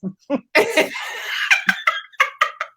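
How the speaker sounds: high breathy laugh; high laughter. Real, not acted. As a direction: laugh at the joke.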